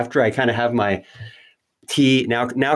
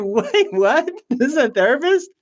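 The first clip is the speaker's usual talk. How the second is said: much higher, incredulous double take; shocked disbelief; 12 semitones higher than usual. Retold or imitated. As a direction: react like you can't believe what you read. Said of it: "When I read it, I was like".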